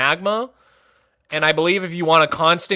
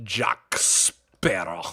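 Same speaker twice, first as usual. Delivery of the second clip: very slow, much lower sneer of a name; Davy Jones impression; 10 semitones lower, 8 dB quieter, much slower than usual. Davy Jones naming his rival, slow low sneer. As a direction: spit the name out slowly and low. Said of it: "I had a Davy Jones in the chamber".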